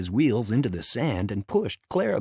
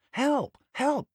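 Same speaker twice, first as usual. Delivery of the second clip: much higher, frightened call for help; panicked cry. A narrator's reading. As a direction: cry out as you slide downhill. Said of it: "said Thomas".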